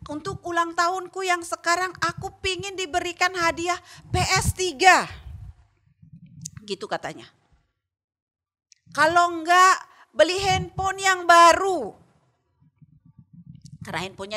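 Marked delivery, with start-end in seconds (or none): 0.1-5.0 put-on child's raised pleading prayer, higher; loud prayer. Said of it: "berdoanya kencang begitu". 9.0-12.0 put-on child's prayer shouted out loud, higher and slow; loud, slow, high child's wish prayer. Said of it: "berdoanya kencang begitu".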